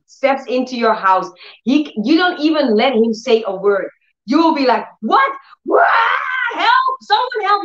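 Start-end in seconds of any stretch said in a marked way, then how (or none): 5.0-7.6 high yelled cries for help; mock scream for help. Preacher acting out a homeowner screaming at a thief. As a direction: cry out as if a thief broke in. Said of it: "You will scream".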